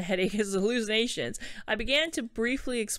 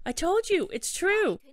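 acted insistence; 9 semitones higher, slower than usual. Acted insistence to disbelieving Yuna.

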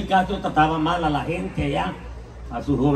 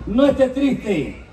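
lightly higher mock healer voice; brujo voice; 7 semitones higher than usual. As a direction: play a smooth-talking witch doctor. Brujo habla a la tía. (brujo de Catemaco con voz algo aguda)

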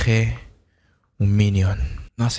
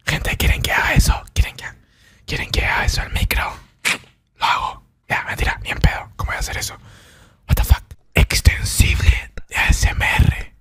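unvoiced whisper throughout; ASMR whisper; 7 semitones lower than usual. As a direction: whisper chat close to mic.

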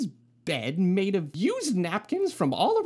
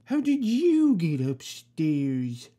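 slow puzzled watchman; 6 semitones lower, slower than usual. Acted. Watchman finds the bear.

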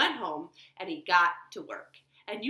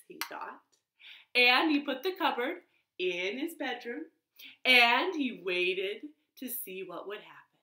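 ordinary delivery, nothing marked

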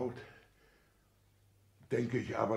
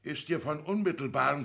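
normal talking voice

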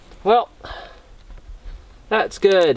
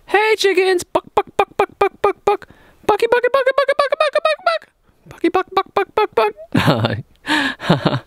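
chicken call. Real, not acted. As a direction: cluck like a hen to bring the chickens. Calling chickens with clucking noises.

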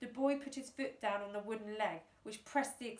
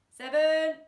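loud count; 6 semitones higher, 13 dB louder than usual. A narrator's reading. Countdown through loud hailer.

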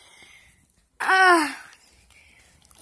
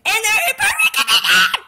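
delighted shout; 8 semitones higher, 8 dB louder than usual. Real, not acted. Delighted by the toys.

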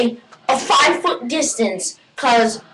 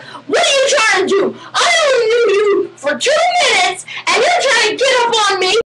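offended yell; 7 semitones higher than usual. Acted. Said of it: "push them away and be like".

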